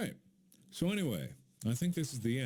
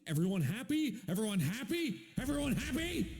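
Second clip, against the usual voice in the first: rising teasing question; 11 semitones higher than usual. Acted.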